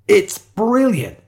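high, loud, drawn-out delight; excited cry. A narrator's reading. Shiloh reaching for city bike, thrilled. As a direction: cry out in admiration. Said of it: "cried Shiloh".

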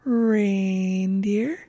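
stretched-out word with a rising end; imitating a cartoon calf's long, rising "Reindeer"; Annabelle's way. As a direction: draw REINDEER out slowly, lifting at the end. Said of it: "and she'd always say it".

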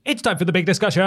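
upbeat segment announcer. Acted. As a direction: announce it like a show host.

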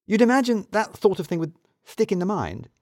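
lightly voiced woman, amazed; incredulous Edie.

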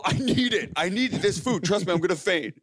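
high-pitched mock desperation; panicky pleading. Panicking customer to Starbucks worker about needing food, frantic pleading.

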